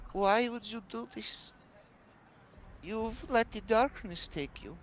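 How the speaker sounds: put-on a hurt girl asking why he changed; young girl accusing a friend, high and slow; upset young girl. Girl recoils, accuses Eric.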